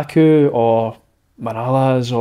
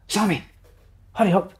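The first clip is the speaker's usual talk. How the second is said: restrained call, pitched up to chivvy him; imitated urging; 6 semitones higher than usual. Assistant manager hurrying substitute.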